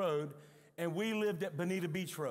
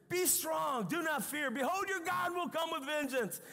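full-force preaching; 7 semitones higher than usual. Acted. Preacher shouting Isaiah's promise to the church. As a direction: thunder the promise out to the congregation.

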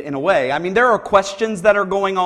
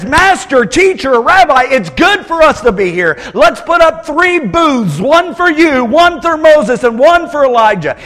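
higher, louder, eager rush of ideas; nervous eager Peter; 8 semitones higher, 7 dB louder than usual. Retold or imitated. Peter interrupting Jesus, Moses and Elijah, higher and eager. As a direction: blurt it out in nervous excitement. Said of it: "nervous energy takes over, and he says".

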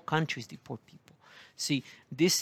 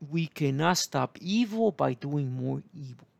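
usual speaking voice

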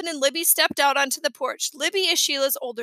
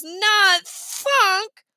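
higher, dismayed, through a held nose; stuffed-nose voice; 6 semitones higher, 4 dB louder than usual. A narrator's reading. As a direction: say it as if badly congested. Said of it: "She held her nose".

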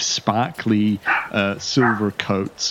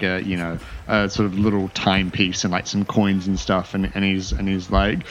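ordinary talk

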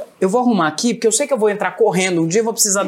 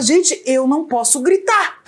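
high, drawn-out mock yell; retold yelling; 6 semitones higher, slower than usual. Mom telling the kids she can't shout, mock yelling. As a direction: complain in a raised, stretched-out voice, half laughing. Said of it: "Então por que você tá gritando?".

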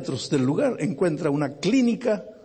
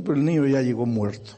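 normal talking voice

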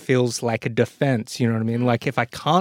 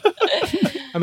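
laughing. Real, not acted. Laughing at the accent.

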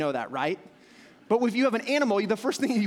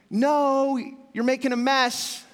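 firm scolding no; 6 semitones higher, 4 dB louder, slower than usual. Shown as a demonstration. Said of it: "what's the tone of no".

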